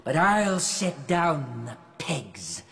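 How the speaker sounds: very slow, drawn-out stage delivery; Iago's aside. Acted. Actor plays Iago's aside in rehearsal, slow declamation. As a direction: stretch each word out theatrically.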